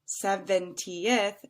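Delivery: "seventieth" slowly as se-ven-ti-eth; four-syllable seventieth. Shown as a demonstration. Teacher counting syllables, "seventieth" slowly in four beats. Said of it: "has four".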